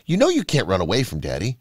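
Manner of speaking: ordinary delivery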